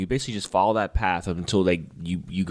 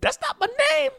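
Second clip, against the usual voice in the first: very high shrieking squeal, imitating Charlie Day. Quoting Charlie Day's scene to his co-host in a high shriek. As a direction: squeal it way up high like a panicking man. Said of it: "Charlie Day is just like".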